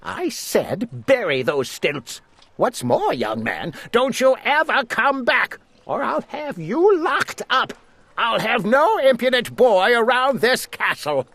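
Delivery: high, rising angry threats; threatening lord. A narrator's reading. Lord Droon banishing the page boy, threatening angrily.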